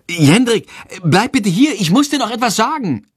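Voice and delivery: high, urgent call after someone; pleading shout at restrained volume. A narrator's reading. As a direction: call him back, voice pitched right up. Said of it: "ruft sie".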